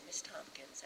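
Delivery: soft whispered name; hushed, kindly address. Retold or imitated. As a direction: call her gently in a whisper. Director's quiet words.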